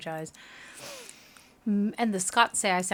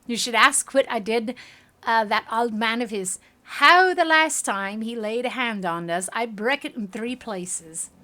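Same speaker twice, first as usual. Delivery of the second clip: loud, boastful Scottish brogue; bad Scottish accent; 3 semitones higher, 7 dB louder than usual. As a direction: brag in a put-on Scottish accent. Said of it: "my Scottish accent just sucks".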